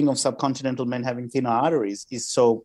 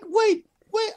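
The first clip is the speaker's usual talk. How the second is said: much higher, stunned "wait, wait"; indignant disbelief; 3 dB louder than usual. Retold or imitated. Comedian stunned a stranger forgot him, high indignant disbelief. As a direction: caught off guard and offended.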